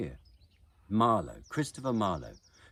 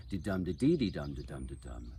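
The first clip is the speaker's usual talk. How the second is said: de-dum pattern with an odd de-dee lifted second; broken iambic rhythm; 4 semitones lower than usual. Shown as a demonstration. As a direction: beat out de-dum but swap in a rising de-dee. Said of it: "what was the dee-dee?".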